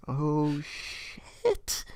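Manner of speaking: breathy, shocked whisper; under your breath, stunned; whispered oh shit. Retold or imitated. Reacting to the rude husband.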